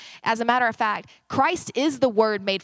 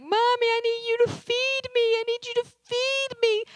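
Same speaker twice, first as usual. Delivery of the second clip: put-on high whiny little girl voice; whiny four-year-old; 14 semitones higher than usual. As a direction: whine like a hungry preschooler. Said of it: "she's whining".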